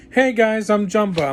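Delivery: mock casual greeting, pitched high and loud; Zach Galifianakis as Jumba. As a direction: chirp it loudly, mocking the miscast actor.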